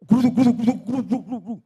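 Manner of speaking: imitated rumbling, rolling syllables; tiruan suara gluduk. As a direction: mimic a clattering rumble that fades off. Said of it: "saya dengar suara". (meniru suara gluduk-gluduk bertubi-tubi)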